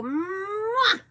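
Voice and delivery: loud high smooch; kiss sound. Shown as a demonstration. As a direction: blow a big noisy kiss. Demonstrating a kiss to toddler.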